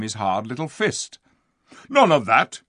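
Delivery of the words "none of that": loud, high dwarf outburst; angry shout. A narrator's reading. Diggle shouting at Lucy, angry. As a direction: shout it to ward her off. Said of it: "he shouted".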